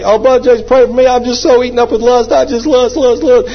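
high-pitched, whiny plea for prayer; hammed-up desperate supplicant; mock pleading. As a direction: plead in a high, put-on voice, piling up the words. Mimics man begging for prayer.

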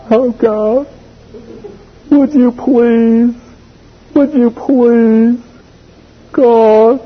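high, slow pleading voice, mocking a needy believer; mock pleading prayer, high and slow; whiny begging prayer. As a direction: beg God in a drawn-out whine.

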